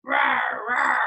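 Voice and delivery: hammed-up roar; lion roar. Shown as a demonstration. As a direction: growl it out like a big lion. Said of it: "What sound do lions make".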